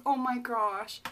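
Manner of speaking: ordinary talk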